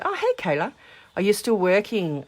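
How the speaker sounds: high, bright surprised hello; suddenly spot a friend; surprised greeting. Real, not acted. Spots a friend in the chat.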